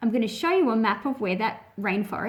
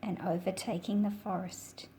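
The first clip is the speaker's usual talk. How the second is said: put-on reading to children. A narrator's reading. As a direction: read the picture book aloud to little listeners, unhurried. Reads picture book aloud.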